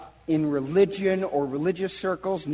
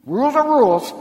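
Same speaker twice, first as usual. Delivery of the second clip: loud, high stern snap; stern rebuke; 9 semitones higher, 8 dB louder, faster than usual. Retold or imitated. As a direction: lay down the law to the outcast woman. Enforcer Jairus scolds the woman.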